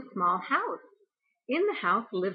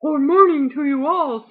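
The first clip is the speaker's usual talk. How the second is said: loud, high booming greeting; very loud storybook dog; Dog character voice; 6 semitones higher, 13 dB louder than usual. A narrator's reading. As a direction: call it out big and hearty as Dog. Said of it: "said Dog".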